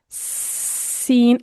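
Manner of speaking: سین with a long hissing whistle; whistling s. Shown as a demonstration. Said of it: "یہ سیٹی کی سی آواز". (سیٹی جیسی آواز میں سین)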